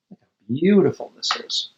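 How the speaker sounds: usual speaking voice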